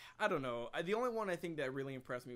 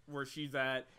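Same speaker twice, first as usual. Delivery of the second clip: normal talking voice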